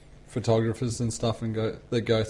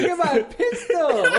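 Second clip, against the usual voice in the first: high silly voice; 14 semitones higher, 4 dB louder, slower than usual. Pretend. Silly voice game banter.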